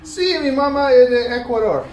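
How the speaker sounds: put-on Spanish accent. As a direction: answer in playful Spanish. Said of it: "allowed to do Spanish accents".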